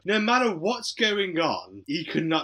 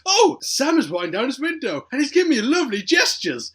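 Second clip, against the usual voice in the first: high, loud chirpy announcer voice; cheery radio presenter; 9 semitones higher, 6 dB louder than usual. Retold or imitated. Radio presenter cheerfully describing the rude gesture, high and loud. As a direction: beam through every word like a delighted DJ. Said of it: "he goes".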